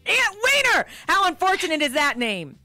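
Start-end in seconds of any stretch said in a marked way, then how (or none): 0.0-0.9 loud high delighted cry; excited exclamation. Real, not acted. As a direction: blurt the name out in loud glee. Shouting comic name.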